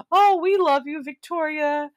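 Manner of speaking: high-pitched, put-on family adoration; mock gushing. Co-host voices family greeting Victoria, mock gushing.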